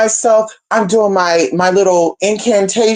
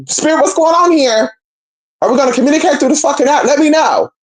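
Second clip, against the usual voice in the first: high, fast pleading rush; exasperated plea; 7 semitones higher, faster than usual. Retold or imitated. Pleading with Spirit over the app, high and fast.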